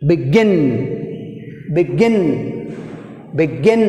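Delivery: "begin" with GIN leaned on; stress on second syllable. Shown as a demonstration. Teacher drilling next word, stressed GIN in begin. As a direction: punch the GIN in each "begin". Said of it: "In second syllable".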